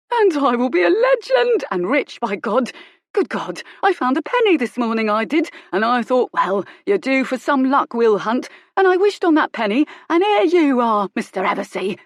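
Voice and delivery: high, loud, greedy thug's voice; gloating villain. Acted. Thug gloating over catching Colin, high and loud. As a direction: crow over the captive like a lucky brute.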